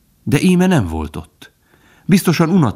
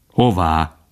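serious. A narrator's reading. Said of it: "Az öreg kérdése komoly volt".

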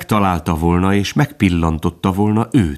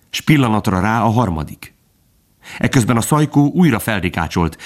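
usual speaking voice

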